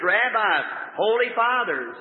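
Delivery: ordinary delivery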